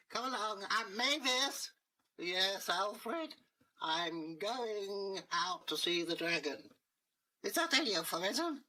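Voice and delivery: high, slow nagging wife voice; shrill wife. Acted. Mayor's wife Mavis, high slow voice.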